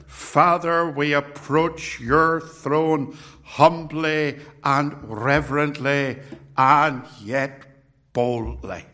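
a slow, lightly put-on Northern Irish preacher praying; Northern Irish accent, solemn and drawn out; Paisley impression. Preacher leading his congregation in prayer, slow Northern Irish accent. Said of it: "I can't do the accent".

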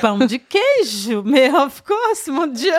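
high, slow, laughing "of course"; laughing delight. Real, not acted. Guest laughing at the obvious choice, delighted and laughing. Picks pão de queijo, delighted.

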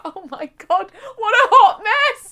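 laughing high and loud; squealing with laughter. Retold or imitated. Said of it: "I was like".

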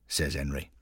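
ordinary delivery, nothing marked

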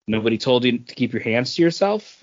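ordinary talk

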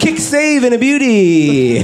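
hammed-up save call, every word drawn out; hockey commentator.

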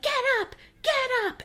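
high yelled command; shouting get up. Retold or imitated. Charles yelling at the man on the stairs, high shout. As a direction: bark it at him to get up. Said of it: "he, he's like".

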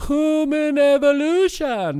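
very high, loud mocking echo; man doing a woman's voice. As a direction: parrot her line in falsetto. Echoing clip guest mockingly.

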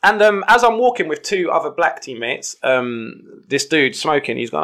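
ordinary talk

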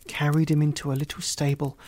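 ordinary delivery

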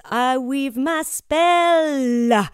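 "spell" with a long, lingering double L, said slowly; stretched double L. Retold or imitated. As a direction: lean on the L at the end of "spell". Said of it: "He'll always really accent that double L".

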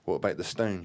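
plain reading voice

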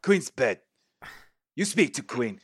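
terse, insistent creature speech; stern lizardfolk explanation, word by word; lizardfolk character. Acted. Lizard guide answering the party.

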